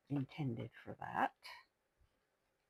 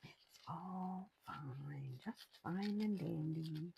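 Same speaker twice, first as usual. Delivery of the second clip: slow, softer ironic whisper; whispered self-reassurance; 3 semitones higher, 6 dB quieter, much slower than usual. Real, not acted. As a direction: soothe yourself wryly in a whisper. Sarcastic self-calming in whisper.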